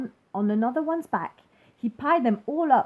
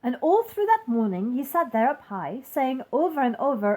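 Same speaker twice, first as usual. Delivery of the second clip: ordinary delivery, nothing marked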